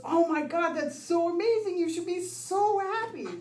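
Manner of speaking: high-pitched, gushing congratulations; imitated gushing excitement. Coworker congratulating her on a year sober, mock gushing. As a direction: gush like a delighted stranger. Girl hears of sobriety anniversary.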